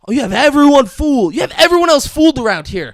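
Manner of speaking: high, loud acted accusation; angry accusation. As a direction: act out a son's heated confrontation. Acted angry confrontation by son.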